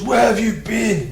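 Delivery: slow, high mock demand from a stern father; a hint of a towering dad, slowed right down; big deep dad voice. Dad confronting son home late, slow mock stern demand. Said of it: "In a big, deep".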